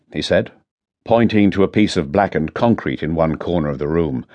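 ordinary delivery, nothing marked

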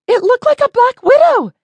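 very high, loud frightened shout; alarmed yell. A narrator's reading. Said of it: "Betty yelled".

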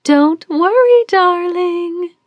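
airy mother calling back to her daughter; called out breezily. Acted. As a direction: toss it back carelessly over the shoulder. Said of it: "Celeste called out over her shoulder".

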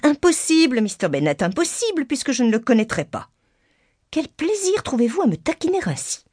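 high-pitched protesting wife; flustered, exasperated mother. A narrator's reading. Wife protests her husband's teasing, exasperated.

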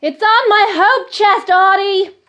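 loud, high call across the house; wife calling up to her husband, helpful; calling back. Acted. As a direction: shout the answer upstairs. Wife calls back to husband upstairs.

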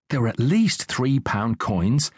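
plain reading voice

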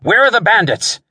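high, urgent shouted question; shouted demand. A narrator's reading. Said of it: "Wentworth shouted".

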